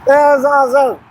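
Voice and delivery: high, thrilled exclamation; excited. Real, not acted. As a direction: gush with delight. Thrilled after passerby's shout.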